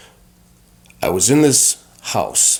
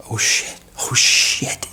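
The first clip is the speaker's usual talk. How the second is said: slow, whispered panic; frightened whisper; slower than usual. Retold or imitated. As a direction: breathe it out in dread. Terrified as the trip starts.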